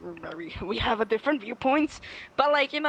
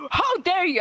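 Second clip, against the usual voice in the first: louder, higher mock indignation; mock outrage; 9 semitones higher, 4 dB louder than usual. Telling how she cursed him out, mock outrage. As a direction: snap back in mock offense. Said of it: "cursing him out".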